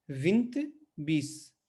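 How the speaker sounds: विंत slow with a nasal इं and the final e dropped; Portuguese vinte. Shown as a demonstration. Teacher slowly models vinte with its meaning. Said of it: "जैसे विंत".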